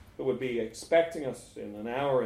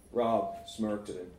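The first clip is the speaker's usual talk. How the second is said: ordinary delivery, nothing marked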